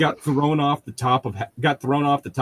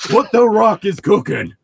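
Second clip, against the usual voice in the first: slow, loud, drawn-out yell, higher; yelled wrestling catchphrase; 8 semitones higher, 4 dB louder, slower than usual. Retold or imitated. Friend yells it just before the table impact, slow loud hype. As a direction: belt it out like a ring announcer hyping the crowd. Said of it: "one of them just yells out".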